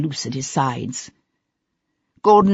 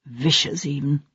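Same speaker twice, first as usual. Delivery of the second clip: trailing off; 2 semitones lower, 6 dB quieter than usual. A narrator's reading. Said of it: "let his voice trail off".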